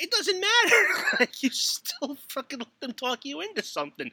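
high laughing "it doesn't matter"; scolding wife while cracking up; laughing exasperation. Retold or imitated.

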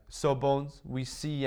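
put-on lively American accent. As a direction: mimic an American press interviewer. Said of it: "that's my American accent".

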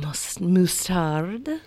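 very slow sultry drawl; hushed sultry voice. Pretend. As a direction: stretch it out, seductive and hushed. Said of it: "hushed, sultry voices".

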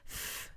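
"f" as a soft, breathy unvoiced hiss; isolated f sound. Shown as a demonstration. Therapist showing parents the fricative "f", breathed softly. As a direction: blow a quiet "f" through the lips. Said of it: "the sound F".

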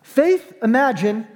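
normal talking voice

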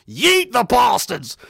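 drawn-out, hammed-up rallying cry; mock battle cry. Reading out a viewer's comment, mock battle cry. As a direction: sing it out slowly like a rallying call.

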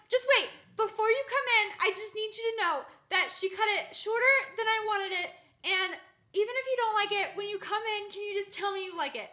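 loud, high, anxious begging; high pleading. Retold or imitated. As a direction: plead desperately through a door. Pleading through locked door.

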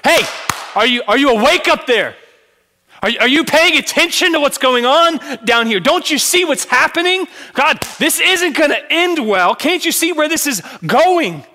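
high, loud, desperate calls to God; frantic shouted prayer; shouting to heaven. Pretend. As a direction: yell up at an absent God. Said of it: "you were shouting".

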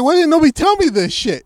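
high, loud indignant cry; hammed-up outrage; outraged. Outraged at not being told.

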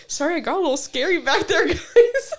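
high, rising, laughing to the end; laughing apology. Retold or imitated. Friend apologising to the group after freaking out, laughing. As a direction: laugh it off sheepishly.

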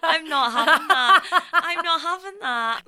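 loud laughing refusal; laughing protest. Real, not acted. Refusing to stay in on birthday.

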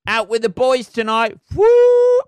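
shouted, high, loud party cheer; mock party whoop. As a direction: whoop it up like a lad on a night out.

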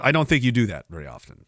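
ordinary talk, nothing marked

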